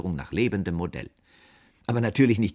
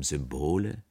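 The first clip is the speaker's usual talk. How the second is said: lower, baffled echo; asking stupidly; 5 semitones lower, slower than usual. A narrator's reading. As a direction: repeat it blankly as a question. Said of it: "fragte ich dumm".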